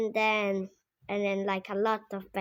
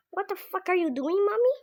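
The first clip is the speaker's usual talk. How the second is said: put-on shocked kid; 9 semitones higher, 3 dB louder, faster than usual. Kid catching mom.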